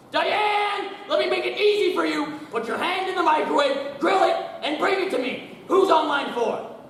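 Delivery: high and loud barked orders; yelling orders. Acted. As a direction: shout across to the secretary. Boss yelling at secretary.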